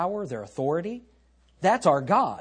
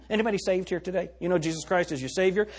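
normal talking voice

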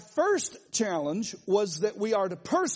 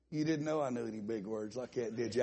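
quoting the guy; 9 semitones lower, 6 dB quieter, faster than usual. Retold or imitated. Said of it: "and he said".

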